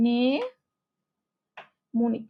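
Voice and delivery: very slow, split after a long first syllable; mnemonic slowly, syllables held apart; drawn-out sounding out. Shown as a demonstration. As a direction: stretch mnemonic out as নে... মোনিক. Sounding out word while writing.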